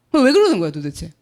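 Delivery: usual speaking voice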